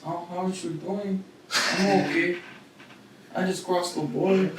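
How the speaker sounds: put-on quiet, mumbled Mexican accent. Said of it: "Okay, dude, go".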